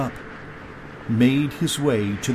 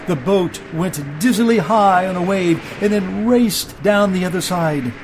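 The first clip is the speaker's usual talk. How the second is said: higher, intense storytelling; urgent storm narration; 6 semitones higher, 4 dB louder than usual. A narrator's reading. Narrator tells of the boat in peril, urgent and raised. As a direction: build the danger with punched key words.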